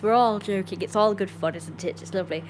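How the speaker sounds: ordinary delivery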